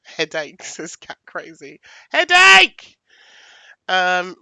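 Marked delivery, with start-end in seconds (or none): 2.1-3.0 sudden loud, high yell; big burst of a high-pitched shout; excited shout. Real, not acted. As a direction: shout it out high at full volume. Calling out the chat suggestion.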